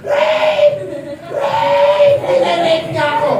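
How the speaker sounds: hammed-up screaming woman; screaming accusation. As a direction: scream it to frame him. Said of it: "Tapos sumigaw siya".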